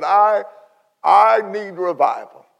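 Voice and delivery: mock-crying outburst; imitating tearful pastor. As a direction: plead it slowly, as if through tears. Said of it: "he was crying on the phone".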